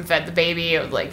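ordinary delivery

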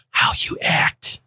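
slow whisper for effect; drawn-out, pointed whisper; emphatic whisper. Real, not acted. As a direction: whisper each word deliberately. Answers own question for effect.

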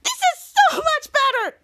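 high thrilled exclamation; excited. Acted. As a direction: squeal with delight. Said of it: "Mika was so excited".

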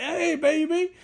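high, leering catcall put on; catcalling guys. Guys calling to women waving at them, catcalling. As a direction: call out to women as a silly flirt.

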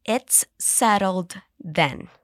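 slow, drawn-out model sentence; slow repeat. Shown as a demonstration. Teacher slowing down for students to repeat. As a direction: say each word slowly for learners. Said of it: "Let's repeat slowly".

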